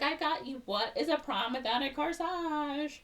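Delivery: high-pitched doting mom voice; gushing mom. Retold or imitated. Mom brings her daughter a corsage, high gushing. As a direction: coo it high, presenting a gift.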